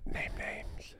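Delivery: whispered, conspiratorial urging. Real, not acted. Urging a friend to reveal the gossip, whispered. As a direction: lean in and whisper it.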